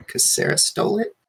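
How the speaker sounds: normal talking voice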